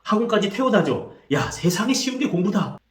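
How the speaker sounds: put-on exasperated parent lecturing a tired kid; aggressive scolding parent. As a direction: nag the child as if study were easy. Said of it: "이렇게 공격적으로 말하는 경우가 많습니다".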